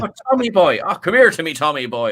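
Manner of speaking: stage Irish mocking the worst accent; bad Irish accent. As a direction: overdo a cartoon Irish brogue. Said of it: "We gotta call it out".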